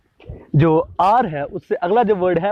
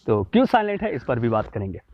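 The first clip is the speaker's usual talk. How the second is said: ordinary delivery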